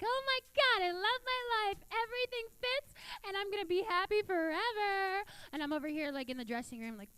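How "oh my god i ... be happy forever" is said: put-on high, gushing valley-girl voice; squealing happy preteen girls; skinny girls. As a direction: gush like a smug skinny girl. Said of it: "all the little skinny girls are like".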